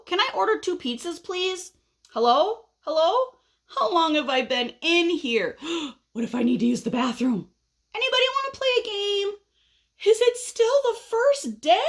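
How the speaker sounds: high, restless pestering calls; very impatient caterpillar. Acted. Caterpillar waiting inside his chrysalis, very impatient. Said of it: "he is being very impatient".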